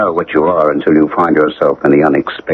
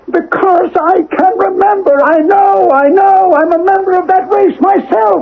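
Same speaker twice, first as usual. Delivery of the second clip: intense confession. Acted. Husband confesses to the policeman that he belongs to the race, high and urgent. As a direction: pour out the secret in a high, driven voice.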